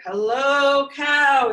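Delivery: high, loud cheerful duck voice; cheery duck greeting. Acted. Duck greeting Cow from his bike, high and loud. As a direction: call out hello like an eager duck.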